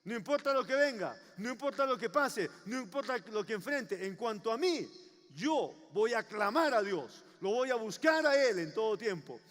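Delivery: emphatic preaching, building to a loud vow; resolute declaration. Acted. Believer vowing to call on God like David, resolute. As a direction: declare it firmly, driving each phrase. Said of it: "semejante a David que pueda decir".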